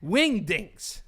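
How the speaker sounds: "Wingdings" said high and punchy; emphatic. Shown as a demonstration. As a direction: overstress each half of "Wingdings". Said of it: "I can't say that without being emphatic".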